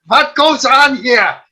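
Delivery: put-on slow, loud, high, heavy German accent; terrible German accent. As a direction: bark it out in a thick German accent. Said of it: "He had this terrible German accent".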